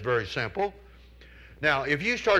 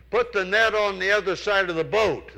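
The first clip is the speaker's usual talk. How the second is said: high, louder call across the water; hollering from the shore; 7 semitones higher, 6 dB louder than usual. Retold or imitated. Jesus calls from the shore to Peter's boat, hollering. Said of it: "So Jesus hollers out to him".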